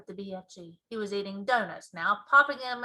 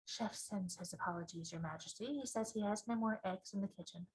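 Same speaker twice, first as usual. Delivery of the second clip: softer butler's murmur; softer, discreet aside; whispered apology; 10 dB quieter than usual. A narrator's reading. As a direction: lean in and tell the Queen quietly. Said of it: "whispered in her ear".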